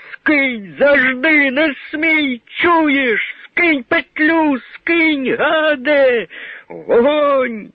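very high desperate yelling; frantic screaming. Acted. Crowd screaming at the hangman, frantic high yell.

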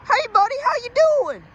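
put-on overdone southern accent, high and loud; exaggerated southern cop. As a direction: hail someone as a hammed-up southern officer. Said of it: "Not like that".

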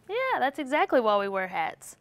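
ordinary delivery

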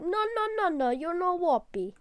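high, loud squeaky refusal; mock game voice. Voicing the game refusing to let him warp, high squeal.

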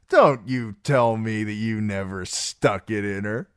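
slow, boozy taunting; tipsy, needling swagger; leering drunken singer. Acted. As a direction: draw out the lewd teasing. Plays Darion teasing Sam.

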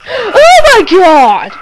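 loud, high, drawn-out shriek; horrified scream. Real, not acted. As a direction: scream it in sudden horror. Scare in the horror footage.

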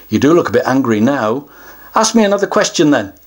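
usual speaking voice

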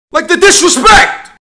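fake-offended exclamation; mock outrage. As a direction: play up the offence. Ranting about being talked over.

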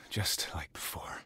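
slow bitter whisper. Acted. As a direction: breathe it out, slowly and bitterly. Alternate take of same line.

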